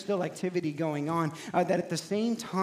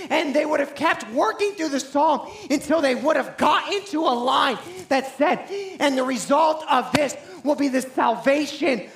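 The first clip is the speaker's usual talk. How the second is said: higher, much louder impassioned preaching; full-force preaching; 10 semitones higher, 10 dB louder than usual. Acted.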